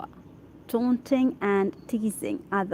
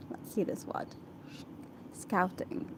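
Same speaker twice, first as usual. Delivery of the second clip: very soft, breath-only muttering to oneself; whispered aside; 11 dB quieter than usual. Real, not acted. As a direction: whisper it privately while looking up a word. Checking a word to herself.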